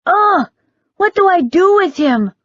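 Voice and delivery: put-on high, loud disgusted yelp of a teen boy. A narrator's reading. Teen boy grossed out by a poodle licking him, high loud disgust. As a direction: recoil from a dog licking his chin. Poodle licks teen's chin.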